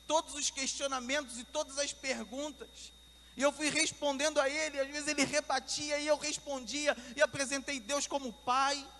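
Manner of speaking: loud, high, driving sermon voice; fervent preaching. Real, not acted. Preacher telling how God gave him answers, fervent. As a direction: preach the testimony with mounting force.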